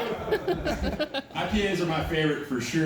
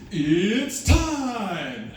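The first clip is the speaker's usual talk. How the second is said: announcer catchphrase; 4 semitones higher, 3 dB louder than usual. Retold or imitated. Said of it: "his catchphrase".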